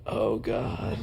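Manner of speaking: soft, low-key prank-call groan; very low moan. Acted. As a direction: come in quietly under the call, as if hurt. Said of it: "start coming in very low".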